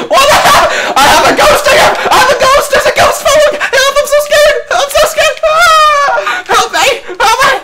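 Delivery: high, laughing mock-terrified yelps; hammed-up fright. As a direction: ham up being scared of the ghost. Hammed-up panic over in-game ghost.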